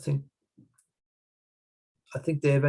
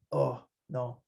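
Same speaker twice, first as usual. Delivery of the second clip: ordinary talk, nothing marked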